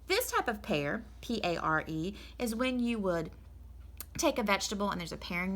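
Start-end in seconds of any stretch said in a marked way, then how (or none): none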